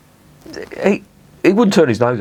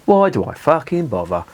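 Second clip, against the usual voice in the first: higher, exasperated repeat of Why do I fucking bother; 6 semitones higher than usual. Shown as a demonstration. Beer reviewer exasperated with himself, exasperated. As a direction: throw up your hands on Why do I fucking bother. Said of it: "repeated with exasperation".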